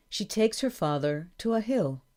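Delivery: ordinary delivery, nothing marked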